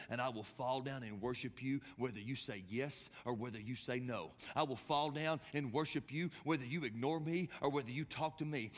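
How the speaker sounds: acted vow of faith, steadily intensifying; resolute devotion. Acts woman's resolute declaration of worship.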